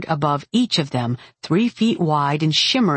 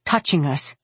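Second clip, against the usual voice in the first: plain reading voice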